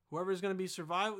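ordinary talk

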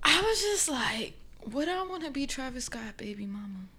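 soft unvoiced whisper. Real, not acted. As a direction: whisper it like a secret. Whispered gossip.

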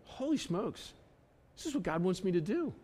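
hushed, soft amazement; softly marvel, pitch lifting on the first words; awed realization. Acted. Reader discovering what God wants, hushed surprise. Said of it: "you're like".

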